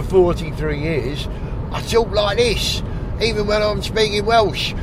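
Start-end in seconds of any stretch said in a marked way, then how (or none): 1.7-4.7 higher-pitched London accent. Shown as a demonstration. Welshman showing how he talks after years in London, London accent. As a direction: put on a Londoner's way of talking. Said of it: "I sound like a Londoner now".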